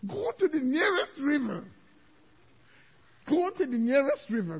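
high, loud order; hammed-up exorcist's order; acted command. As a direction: command it forcefully to leave. Acts out commanding the demon away.

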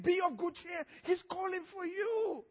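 mock the crowd's switch to sweet encouragement; suddenly encouraging crowd. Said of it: "You see how they switched immediately?".

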